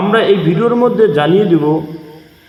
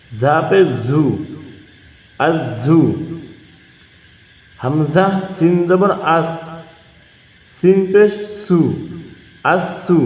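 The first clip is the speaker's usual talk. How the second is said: slow recitation drill; slower than usual. Shown as a demonstration. Teacher showing viewers how to read tashdid with pesh, slow drill chant. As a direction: spell it out like a Quran teacher, falling on আব্বু. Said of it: "দেখিয়ে দেবো".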